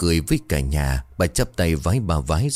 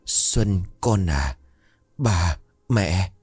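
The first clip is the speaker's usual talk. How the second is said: cool but a touch warm; much slower than usual. Acted. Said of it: "giọng của bà lạnh đi một chút, ánh lên một chút ấm".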